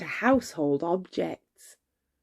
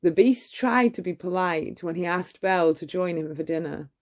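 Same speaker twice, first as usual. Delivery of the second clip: usual speaking voice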